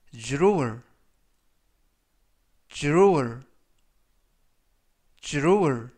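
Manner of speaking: drawer with dr said like a j, a loud clear "jurur"; drawer pronunciation. Shown as a demonstration. Teacher modelling drawer for learners, loud and clear. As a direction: say drawer loudly, three times. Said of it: "이라고 발음하시면 되겠고요".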